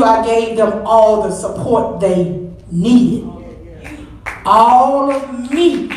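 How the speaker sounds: slow, weighted words; slow emphatic. Real, not acted. Mother telling the church she gave her children all, slow and emphatic. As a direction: stretch each word out deliberately. Slowing to stress devotion.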